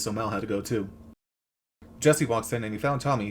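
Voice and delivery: normal talking voice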